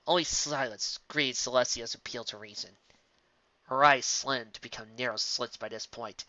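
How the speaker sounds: ordinary delivery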